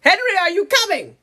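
high, loud haughty summons; mock calling out. Colonizer avatar calling Henry to leave, mock impatient shout. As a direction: holler impatiently for a companion. Snooty character calls Henry.

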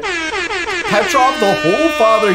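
high, loud grand announcement; hyping the celebrity to chat, loud and high; excited proclamation. Real, not acted. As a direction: proclaim him like a legend. Hyped proclamation of celebrity viewer.

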